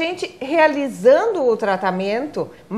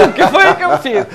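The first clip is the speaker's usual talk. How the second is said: laughing mock innocence; playful laugh, acting the caught child; 3 semitones higher, 7 dB louder than usual. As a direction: giggle out a pretend guilty question. Mock guilty child joke.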